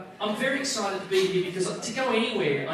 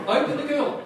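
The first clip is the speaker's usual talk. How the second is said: laughing repeat; 6 semitones higher, 7 dB louder than usual. Real, not acted. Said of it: "That is a Freudian slap".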